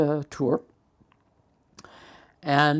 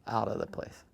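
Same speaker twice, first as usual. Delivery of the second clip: plain reading voice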